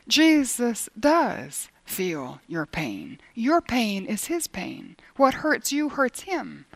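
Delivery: slow, weighty insistence; slow and deliberate. Real, not acted. Preacher insisting Jesus feels their pain, slow and deliberate. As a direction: lay each word down slowly.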